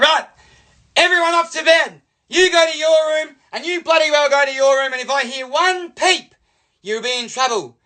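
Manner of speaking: mum cracking it. Retold or imitated. Cross mum sending her sons to bed, high angry scolding. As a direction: order the kids off like a fed-up mother. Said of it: "Mum, she cracked it".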